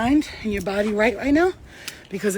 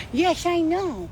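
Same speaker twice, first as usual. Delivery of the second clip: cooing pet talk; 8 semitones higher, much slower than usual. Real, not acted. Comforting the dog Luna, high cooing. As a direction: coo sympathy to a little dog. Soothing own little dog.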